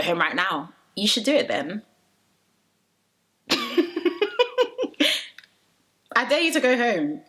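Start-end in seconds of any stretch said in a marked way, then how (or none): 3.5-5.2 high, bursting real laugh; burst of laughter. Real, not acted. Laughing at own dare.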